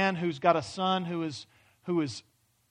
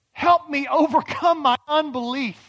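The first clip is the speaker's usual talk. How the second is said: loud, pleading cry for help; father exclaims; 7 semitones higher, 8 dB louder than usual. Retold or imitated. Father begs Jesus for help, loud and pleading. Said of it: "He exclaimed".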